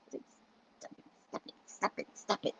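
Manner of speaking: barely voiced, gentle; soft pleading whisper. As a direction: plead softly, almost silent. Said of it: "in a nice way".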